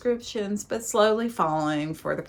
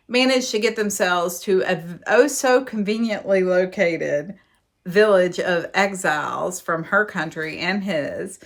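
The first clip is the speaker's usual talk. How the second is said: normal talking voice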